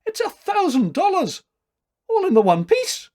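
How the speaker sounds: high, loud amazed Scots exclamation; awestruck Scot. Acted. Tailor marvels at the thousand-dollar cheque, loud Scottish amazement. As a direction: marvel aloud in a Scottish accent. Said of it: "cried the awe-stricken Scot".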